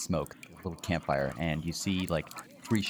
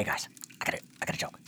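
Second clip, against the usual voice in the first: goblin whispering; 7 dB quieter, faster than usual. Acted. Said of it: "he says".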